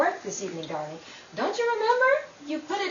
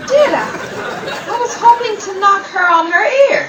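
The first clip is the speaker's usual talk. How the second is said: gloating; 6 semitones higher, 10 dB louder than usual. Acted. Gloating after knocking rival over.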